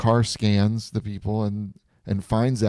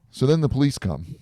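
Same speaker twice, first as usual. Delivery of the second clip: normal talking voice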